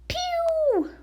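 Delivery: squeaky high zap noise for the blackout; high "pew" sound effect. Acted. Potato mimics the power cutting out with a high zap. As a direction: make a tiny cartoon zap sound.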